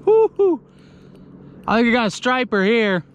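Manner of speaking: usual speaking voice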